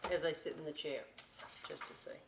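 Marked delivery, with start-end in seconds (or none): none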